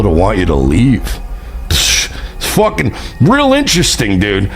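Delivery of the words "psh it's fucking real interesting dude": high, sneering mock lecture; mocking sarcasm. Real, not acted. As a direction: talk down to him, pitched up.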